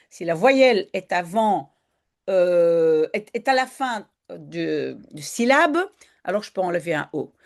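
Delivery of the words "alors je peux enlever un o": usual speaking voice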